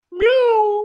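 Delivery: high loud playful meow; cat meow. Acted. Man teasingly meowing at his sweetheart he calls kitten.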